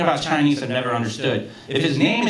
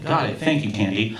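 ordinary talk

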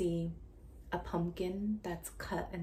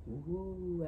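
ordinary delivery, nothing marked

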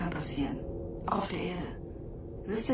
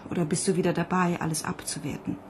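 ordinary talk, nothing marked

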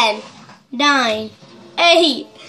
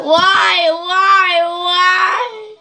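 whining protest; 4 semitones higher, much slower than usual. Real, not acted. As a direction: whine why over and over. Forced to lick hot sauce.

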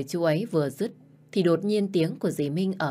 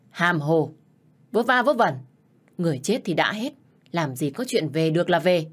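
angry dismissal; 3 dB louder than usual. Acted. Stepmother scolds the wife for ghost talk, scornful anger. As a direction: shut down the silly talk hard.

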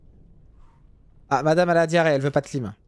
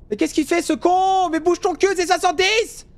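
road-rage yell; 13 semitones higher than usual. Real, not acted. Taxi driver yelling at a slow car, furious. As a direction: curse out the dawdling driver.

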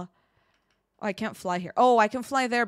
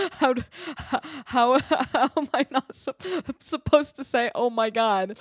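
higher voice broken by laughter; real laughing disbelief; 3 semitones higher, 4 dB louder than usual. Real, not acted. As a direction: try to ask while cracking up. Cracks up over banned words.

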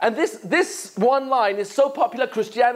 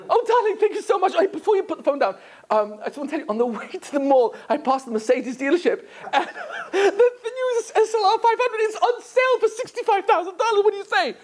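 higher, coaxing wife asking for a pricey car; sweet-talking wife; 10 semitones higher than usual. Acted. Wife on speakerphone sweet-talks her husband, higher and coaxing. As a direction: wheedle like a wife angling for a gift.

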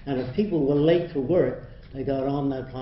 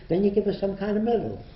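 normal talking voice

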